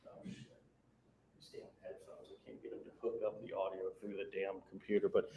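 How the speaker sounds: faint voice from across the room; speaking far from the microphone; far from mic. Real, not acted. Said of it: "you sound like you're far away".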